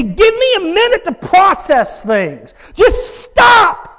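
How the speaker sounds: high, loud plea that bursts into a shout of stop; a person crushed by trouble, high and loud; overwhelmed plea. Retold or imitated. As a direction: beg for everything to halt. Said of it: "push a pause button and say".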